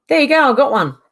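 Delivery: high and loud, pleased; triumphant exclaim. Real, not acted. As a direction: announce the win loudly. Triumphant after blocking user.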